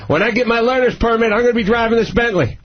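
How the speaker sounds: high-pitched mock kid voice; bragging eleven-year-old. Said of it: "eleven-year-old going".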